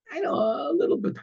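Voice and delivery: high, sheepish admission; sheepish listener. Pretend. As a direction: concede it grudgingly like a caught student. Imagined listener admits giving little.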